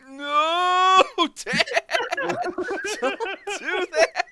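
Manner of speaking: high, loud laughing wail; mortified cry. Retold or imitated. Teen stopping embarrassing dad at garage sale, loud laughing wail. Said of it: "And I'm like".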